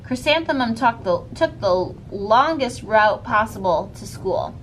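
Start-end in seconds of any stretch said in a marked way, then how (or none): none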